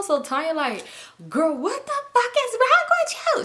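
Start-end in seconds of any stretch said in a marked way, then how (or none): 1.3-3.4 high, loud mock yell at a friend; loud outburst. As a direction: yell it high across the car. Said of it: "She loud".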